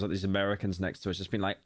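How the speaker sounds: ordinary talk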